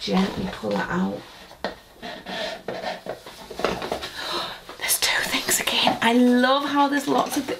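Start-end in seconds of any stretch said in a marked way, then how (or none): 1.6-6.0 excited hushed whisper. Real, not acted. Whispering at the advent drawer to viewers. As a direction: breathe it out in awe.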